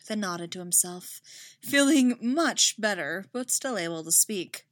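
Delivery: plain reading voice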